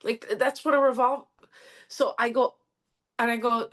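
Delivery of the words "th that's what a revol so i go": ordinary talk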